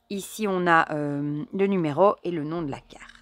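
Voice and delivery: ordinary talk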